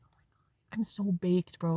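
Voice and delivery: whispered, dazed and stoned; hushed stoned confession. Retold or imitated. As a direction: whisper it like you're totally baked. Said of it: "I'm like".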